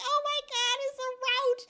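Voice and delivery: high squeal at normal volume; mock screaming panic. Woman fleeing the running roach, mock panic. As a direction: imitate a helpless freak-out. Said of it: "I start screaming".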